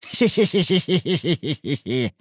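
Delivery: high villainous hee-hee giggle; evil chuckle. Acted. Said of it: "своим фирменным злодейским смехом".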